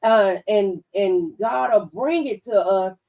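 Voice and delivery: ordinary talk, nothing marked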